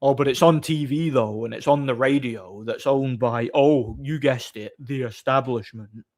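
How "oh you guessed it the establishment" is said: normal talking voice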